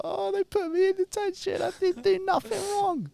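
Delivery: put-on very high-pitched childish wail; mock crying. Child protesting to mum, mock crying. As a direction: put on a squealing tearful whine. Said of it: "I just started crying".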